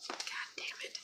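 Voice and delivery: hushed, breathless curse; whisper. Real, not acted. Sad owner watching the dog wait at the door, whispered. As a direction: swallow the curse almost silently.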